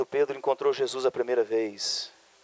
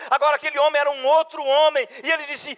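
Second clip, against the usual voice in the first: high, loud rapid-fire preaching cadence; pregação inflamada; 7 dB louder than usual. Real, not acted. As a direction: preach at full force, driving phrase after phrase. Preaches at full force. (pregação fervorosa, voz alta e aguda)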